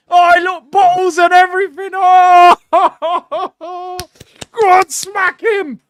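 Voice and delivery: high, loud shouts breaking into laughter; gleeful shouting. Real, not acted. Fan watching bottles fly, gleeful shouting. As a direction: egg them on, laughing and yelling.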